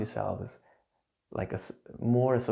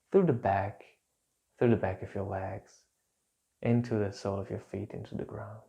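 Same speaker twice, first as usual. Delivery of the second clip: calm grounding exercise. Acted. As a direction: gently guide them to let tension drain. Leads a grounding meditation.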